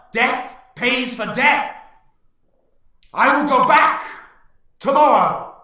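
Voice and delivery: high, loud, slow vow of revenge; snarling orc warlord. Acted. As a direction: snarl it with menace. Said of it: "leader, snarls".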